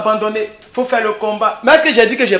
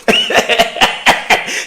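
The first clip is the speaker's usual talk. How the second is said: high-pitched laugh; 4 dB quieter than usual. Real, not acted. Laughing at his own boast.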